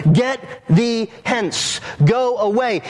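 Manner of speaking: "Get thee hence" slow and high, each word punched, then "Go away"; emphatic command. Acted. Jesus ordering Satan away, emphatic "Get thee hence. Go away". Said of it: "it's emphatic".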